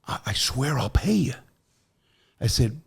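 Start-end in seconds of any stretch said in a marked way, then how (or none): 0.1-1.3 secretive whisper; hushed, urgent whispering; whispered promise. Retold or imitated. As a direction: whisper it so the guards can't hear.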